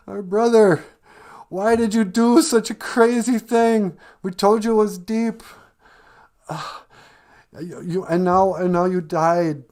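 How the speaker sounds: high, loud wailing grief; keen over him like a lost brother; crying lament. Acted. The animals mourn the drowned muskrat, loud high wailing. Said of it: "And they started to cry".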